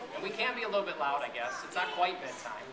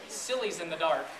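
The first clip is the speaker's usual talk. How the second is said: ordinary delivery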